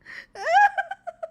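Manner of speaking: high, loud wheezing laugh; real hysterical laugh. Real, not acted. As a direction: burst out laughing. Breaks into laughter.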